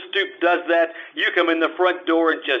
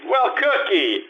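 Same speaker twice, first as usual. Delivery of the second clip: higher, sing-song mock delight; mock surprised greeting; 9 semitones higher, slower than usual. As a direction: greet him like a pleasant surprise, ironic. Marlowe greets intruder Cookie unexpectedly.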